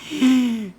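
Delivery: high startled intake of breath; sharp gasp. Real, not acted. Gamer realizing danger, sharp startled gasp. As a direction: gasp as it dawns on you.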